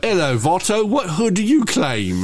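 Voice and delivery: exaggerated British accent for a street-gang line. Comedian's future film about Bloods and Crips, British accent. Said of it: "speaking with British accents".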